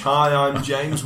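normal talking voice

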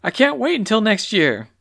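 high, loud, panicky hen voice; high-pitched alarm; clucking distress. A narrator's reading. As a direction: wail it high like a flustered hen. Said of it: "clucked Clucky".

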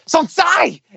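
sudden high shouted word; mock shout. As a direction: bark it out high and sharp. Acted shout in a foreign language.

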